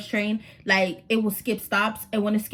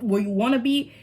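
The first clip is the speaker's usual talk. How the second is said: ordinary talk, nothing marked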